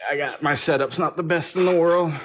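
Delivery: usual speaking voice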